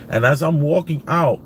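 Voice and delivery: ordinary talk, nothing marked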